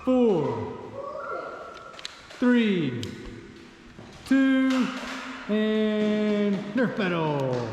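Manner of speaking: very slow, drawn-out announcer call. Pretend. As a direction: stretch it out like a ring announcer opening the fight. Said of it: "I just really wanted to be an announcer".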